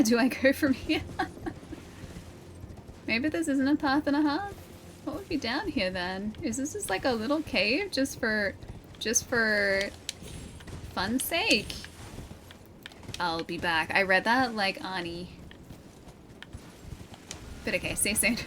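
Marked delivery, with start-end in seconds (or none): none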